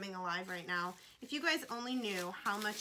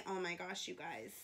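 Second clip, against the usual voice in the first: ordinary talk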